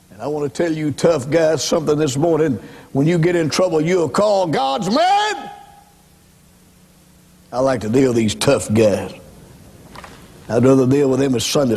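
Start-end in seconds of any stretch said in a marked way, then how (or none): none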